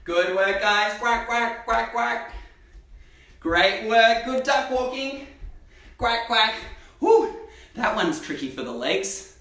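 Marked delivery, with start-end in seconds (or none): 1.0-2.3 playful mock quacking for the kids; duck quacks. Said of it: "Can I hear some quacks?". 6.0-6.5 playful mock quacking for the kids; duck quacks. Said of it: "Can I hear some quacks?". 7.0-7.3 a high, breathy whew of effort; relieved whew. Real, not acted. Relief after tricky leg exercise.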